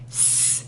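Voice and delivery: S, k, ab whispered apart; hushed phoneme segmenting; whispered sounds. Shown as a demonstration. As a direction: whisper each sound separately. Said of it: "here we go".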